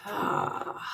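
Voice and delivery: long, soft breathy sigh out; sigh of release. Shown as a demonstration. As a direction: let the breath go in a relieved sigh. Models exhale on muscle release.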